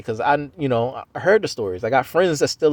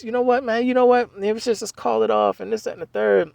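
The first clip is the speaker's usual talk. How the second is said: fed-up outburst; 9 semitones higher than usual. Retold or imitated. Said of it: "I'm just".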